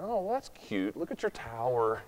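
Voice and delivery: soft, dry mock praise; sarcastic God. Preacher voices God mocking the tower, soft sarcasm. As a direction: pat them on the head, sarcastically. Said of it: "being really sarcastic".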